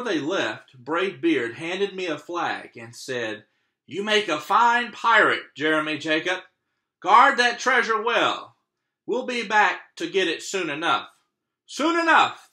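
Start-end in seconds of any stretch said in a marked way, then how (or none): 3.8-11.1 higher, louder hearty captain; hearty pirate captain. Acted. Captain bidding the boy farewell, hearty. 11.7-12.5 high, loud crew echo. Acted. Pirate crew echoing the captain, loud. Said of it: "the crowd repeated".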